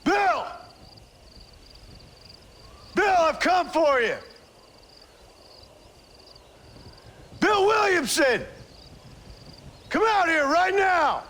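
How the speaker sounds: put-on a gunman calling his old partner out of the fort. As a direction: call him out across the yard.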